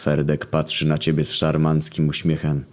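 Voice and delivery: usual speaking voice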